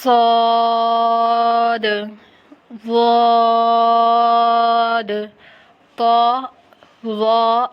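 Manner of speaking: صاد، ضاد، طا، ظا each sustained on a level note; slow, full chant of صاد، ضاد، طا، ظا; drawn-out letter names. Shown as a demonstration. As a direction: hold each heavy vowel of صاد، ضاد، طا، ظا. Models each letter for learners.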